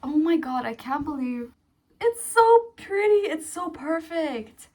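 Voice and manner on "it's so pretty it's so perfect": high, drawn-out gushing; thrilled, high-pitched adoration; gushing delight. Real, not acted. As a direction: gush slowly, stretching the praise. Gushing over new purchase.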